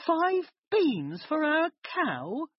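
high, slow outraged cry; a mother crying out in disbelief; shocked cry. A narrator's reading. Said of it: "cried his mother".

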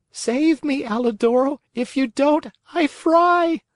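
very high, tiny pleading voice; pitiful little voice. A narrator's reading. Marionette in the fisherman's hand begging the dog, pitiful. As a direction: squeak out a plea for rescue, rising to the end. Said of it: "a pitiful little voice was heard".

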